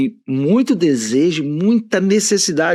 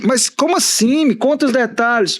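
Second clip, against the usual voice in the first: esposa curiosa imitada; 9 semitones higher than usual. Retold or imitated. Wife asking for details.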